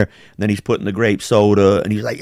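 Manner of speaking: normal talking voice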